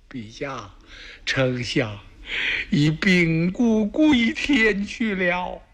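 slow, drawn-out grieving report; weeping messenger. Acted. Messenger telling the emperor the chancellor died, slow grieving wail. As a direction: break the news of the death through tears. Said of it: "放声大哭".

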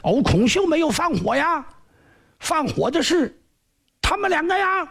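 high wailing plea of innocence; crying injustice. Acted. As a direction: wail up high, begging the master. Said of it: "大声喊冤呐".

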